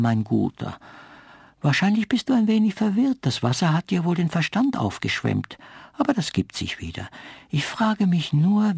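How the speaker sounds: high, mocking condescending lady; haughty willow. A narrator's reading. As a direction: patronise him with high amusement.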